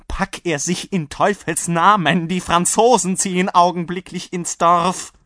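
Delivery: high, loud, alarmed urging; frantic host. A narrator's reading. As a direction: drive the guest off before danger arrives. Frantic host urges rider away. (panisch drängend, laut und hoch)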